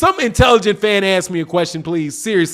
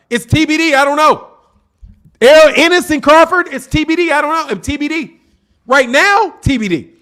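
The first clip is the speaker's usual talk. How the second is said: excited insistence; 6 semitones higher than usual. Real, not acted. Excitedly repeating verdict.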